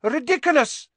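high, loud cry; very high-pitched shouted incantation; cried out. A narrator's reading. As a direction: cry it out like a spell. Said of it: "cried Parvati".

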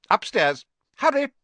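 high, loud barked commands; shrill, hurried ordering; urgent order. A narrator's reading. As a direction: shoo them off sharply. Aunt orders Harry upstairs.

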